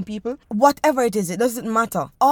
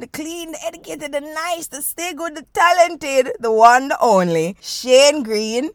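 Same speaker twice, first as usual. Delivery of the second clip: hype introduction; 5 semitones higher, 5 dB louder than usual. Acted.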